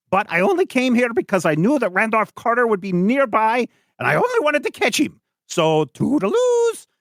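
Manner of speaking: put-on high, chattering old eccentric; Doctor West. Doctor West talking with the narwhal and the Arbogasts, high and chatty. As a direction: prattle on brightly, high-pitched. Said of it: "then he's like".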